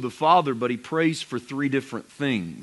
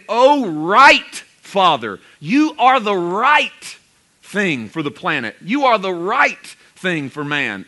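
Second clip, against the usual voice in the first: higher, louder, very slow; fervent declaration; 4 semitones higher, 5 dB louder, slower than usual. Shown as a demonstration. Preacher voicing Jesus' cry to the Father, slow and fervent. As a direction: proclaim "O right Father" slowly and loudly. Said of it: "He's saying".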